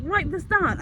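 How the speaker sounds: mock teacher bark. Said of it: "She's like".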